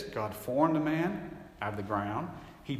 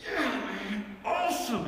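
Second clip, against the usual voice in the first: high, louder whoop of triumph; excited cheer; 9 semitones higher, 3 dB louder than usual. Retold or imitated. Man celebrating a deal he won, high and excited. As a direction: crow about the big win. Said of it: "You're like".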